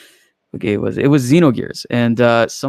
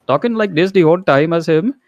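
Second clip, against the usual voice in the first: a put-on Indian accent, higher and louder; 6 semitones higher, 4 dB louder than usual. Shown as a demonstration. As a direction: lay on a joke Indian accent to show it off. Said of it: "Indian accent".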